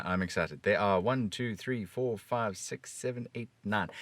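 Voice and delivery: usual speaking voice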